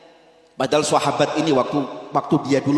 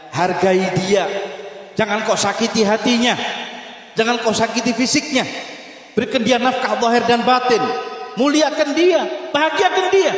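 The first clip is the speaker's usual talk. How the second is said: climbing higher, slowing on the last commands; rising sermon exhortation; 7 semitones higher than usual. Acted. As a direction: preach the Prophet's advice to a peak. Preacher's rising admonition.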